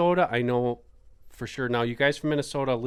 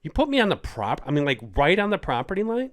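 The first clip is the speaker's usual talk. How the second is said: retold outburst; 6 semitones higher, 5 dB louder, faster than usual. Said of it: "I just completely changed".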